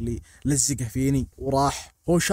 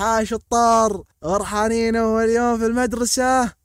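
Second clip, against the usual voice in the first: mock teacher voice, higher, louder and slowly drawn out; sing-song a fake cheerful welcome; cheery teacher; 6 semitones higher, 5 dB louder, slower than usual. Drama teacher hyping up scared first graders, higher and louder.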